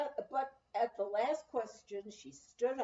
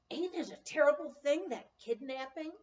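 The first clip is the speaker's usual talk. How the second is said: exclaimed; 5 semitones higher, 4 dB louder than usual. A narrator's reading. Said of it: "the woman from the cabin camp exclaimed".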